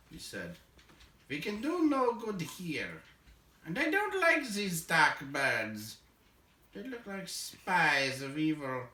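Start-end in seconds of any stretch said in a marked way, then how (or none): 1.3-8.9 higher, slow, wary dwarf voice; old dwarf spooked by dark birds; uneasy old dwarf Balin. Acted. Balin urges Bilbo to turn back, uneasy and slow.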